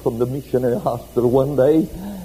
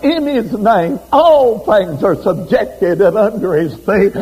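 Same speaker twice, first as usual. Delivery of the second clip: raised, proclaiming preacher voice; preaching; 6 semitones higher, 4 dB louder than usual. Acted. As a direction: lift the congregation with it.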